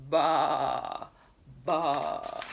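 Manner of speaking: low, bleating baa; sheep baa. Retold or imitated. Reading to a toddler, low sheep bleat. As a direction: bleat like a lamb. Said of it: "What sound does her baby make?".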